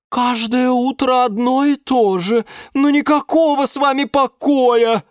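high, loud weary complaint; exasperated sigh. A narrator's reading. Liana on the ceiling sighing over the daily chaos, exasperated. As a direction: grumble it as an everyday annoyance. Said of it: "вздохнула с потолка Лиана Диана".

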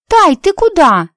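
high, loud, slow call. A narrator's reading. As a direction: call after her, surprised. Friend calling after her.

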